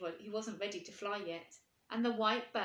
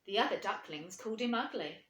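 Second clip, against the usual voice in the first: ordinary delivery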